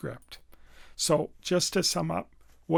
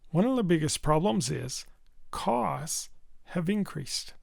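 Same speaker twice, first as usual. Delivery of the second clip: brisk lead-in, pause, isolated "costs"; stressed key points. Shown as a demonstration. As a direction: lead a read-along, "costs" held between two pauses. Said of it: "using our voice to stress key points".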